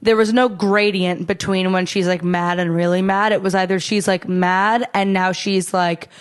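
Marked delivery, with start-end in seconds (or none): none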